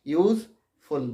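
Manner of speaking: Useful with a gap between ইউজ and ফুল; slight pause mid-word. Shown as a demonstration. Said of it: "থামছি কি না একটু". (Useful, মাঝে একটু থেমে)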